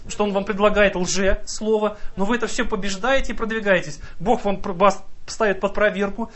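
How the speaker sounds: ordinary delivery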